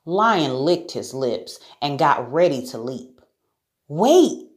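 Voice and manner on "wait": high, louder interjection; acted girl's urgent halt; urgent Sarah. A narrator's reading. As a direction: stop the lion with a sudden high cry. Sarah stops the pouncing lion.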